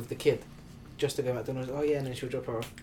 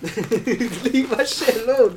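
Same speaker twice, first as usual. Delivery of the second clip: laughing; 8 semitones higher, 8 dB louder, much slower than usual. Real, not acted. Laughing while protesting his handle.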